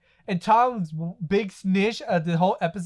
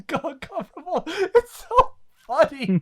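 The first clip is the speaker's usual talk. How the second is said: breaking up with laughter, pitch climbing; helpless giggling speech; laughing while talking; 6 semitones higher than usual. Real, not acted. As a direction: squeeze the words out through real laughter. Cracking up at own retelling.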